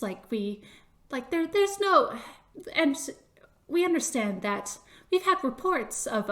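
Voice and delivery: high, halting little bureaucrat; hesitant probing with a small high voice; mouse official. Acted. Mouse official questions Chris about rogue vegetables, tentative and high. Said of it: "the worn mouse goes".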